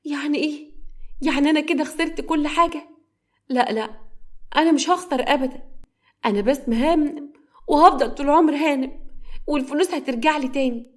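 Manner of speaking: high-pitched, mildly distraught woman; falling apart. A narrator's reading. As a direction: slide from disbelief into desperate defiance. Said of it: "وهي منهارة". (انهيار خفيف بصوت رفيع)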